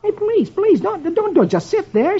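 high, stammering begging; pleading. A narrator's reading. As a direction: beg a silent parrot to talk. Dagwood begs the parrot.

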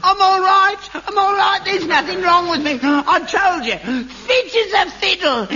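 high, loud, cocky relief; gleeful relief, fit as a fiddle; relieved boasting. Real, not acted. Relieved father boasts his health.

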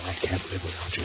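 soft pleading whisper; whispered plea. Acted. Husband silently begging his wife not to leave, whispered. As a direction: breathe it out desperately.

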